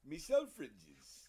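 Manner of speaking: put-on slow, loud Geordie accent; drawn-out Newcastle shopkeeper voice. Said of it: "I knew I'd get an accent out of that".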